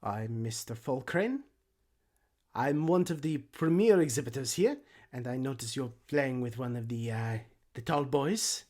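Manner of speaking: busybody gnome with a put-on accent; accented gnome character voice; gnomish exhibitor. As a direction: play an officious little exhibitor introducing himself.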